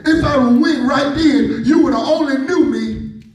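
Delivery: building, soaring preacher cry over music; preaching at full force. Acted. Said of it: "God don't want me to talk like this".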